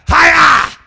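loud, high "Hiya!" yell; karate battle cry. Acted. Preacher yelling a karate cry at trouble. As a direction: shout it like a martial arts strike.

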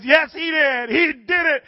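high, loud jubilant insistence; imitated overjoyed woman; excited insistence. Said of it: "She says".

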